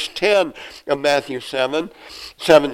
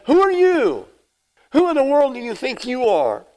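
high, fast challenging voice of the priests; incredulous questioners; 9 semitones higher than usual. Acted. Priests confront John, high incredulous challenge. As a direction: demand to know who he is.

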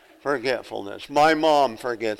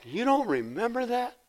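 high, softer disbelieving question; pitched-up mock disbelief; incredulous; 8 semitones higher, 6 dB quieter than usual. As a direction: imitate relatives' astonishment. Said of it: "they look at me like".

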